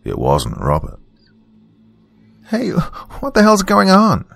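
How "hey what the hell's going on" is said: startled daughter. Acted. Daughter stumbles out of car.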